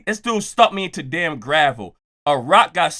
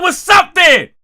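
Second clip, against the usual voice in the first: much higher, much louder shouted challenge; furious shouted dare; angry challenge; 11 semitones higher, 8 dB louder, slower than usual. Retold or imitated. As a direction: yell it right at him, daring him. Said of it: "I can't control my anger".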